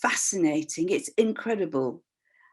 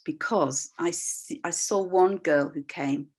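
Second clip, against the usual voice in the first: ordinary talk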